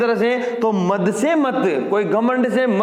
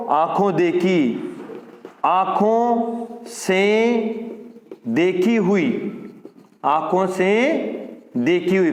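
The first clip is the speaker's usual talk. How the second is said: slow split; slower than usual. Shown as a demonstration. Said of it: "तो आंखों से देखी हुई".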